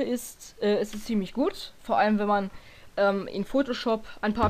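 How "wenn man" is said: ordinary delivery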